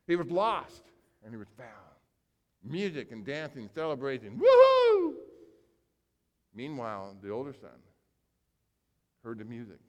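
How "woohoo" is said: high, loud woohoo; joyful party whoop; whooping cheer. Retold or imitated. Celebration with music and dancing.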